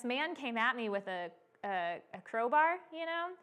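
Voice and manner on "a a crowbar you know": usual speaking voice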